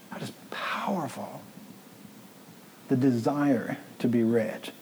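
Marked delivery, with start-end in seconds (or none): 0.1-1.4 whispered in awe; hushed, reverent whisper; 1 awed whisper. Real, not acted. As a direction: breathe it out in a hush. Awed aside after scripture.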